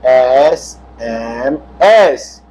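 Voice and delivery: एसएमएस said slowly, first sound a vowel; pronunciation demo. Shown as a demonstration. Teacher shows how एसएमएस starts with a vowel sound. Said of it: "उच्चारण देखिए".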